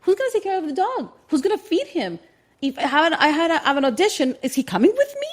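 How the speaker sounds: frantic high worried babble; mock panic. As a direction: rattle them off in rising panic. Repeated, rising into panic.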